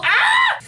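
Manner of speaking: sudden high-pitched squeal. Real, not acted. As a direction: let out a high playful squeal. Squeal again.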